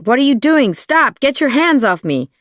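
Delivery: high, loud panicked-sounding protest; yelling. A narrator's reading. Woman in a car of abductors faking an attack, high loud yelling. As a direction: yell as if being grabbed. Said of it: "I start yelling".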